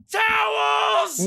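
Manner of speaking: high-pitched, drawn-out mock scream; sudden high cry for help, acted out; imitated scream. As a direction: shriek it like a panicked nurse calling out. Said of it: "And she screams".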